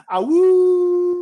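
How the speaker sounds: high, loud wolf howl. Acted. GM voices injured wolf howl.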